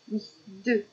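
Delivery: ordinary delivery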